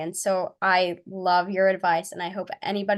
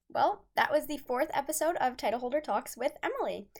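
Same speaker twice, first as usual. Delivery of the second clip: softer, even sign-off read; podcast outro; 3 semitones higher, 6 dB quieter than usual. Acted. Host closing the episode for her listeners, soft outro read. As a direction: wrap up the show for listeners.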